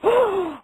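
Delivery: a sharp, high mock gasp of shock; scandalized gasp. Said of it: "the grandma's like".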